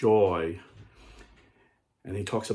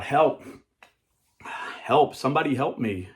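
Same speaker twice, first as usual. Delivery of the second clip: higher-pitched cry for help; acted shout; 4 semitones higher, 4 dB louder than usual. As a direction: call for help, playing the man trapped in a pit. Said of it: "he started shouting out".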